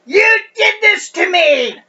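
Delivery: very high, loud, drawn-out accusing scream; acted scream. Woman in labor blaming her husband, hammed-up scream. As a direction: scream it at him, stretching every word. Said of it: "and she screams".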